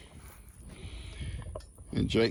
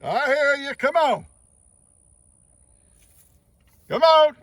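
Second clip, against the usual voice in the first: high, loud call across the pasture; 6 dB louder than usual. Real, not acted. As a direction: call the bull in from far off. Calling the bull across pasture.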